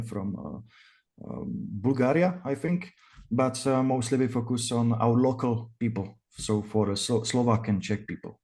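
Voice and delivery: usual speaking voice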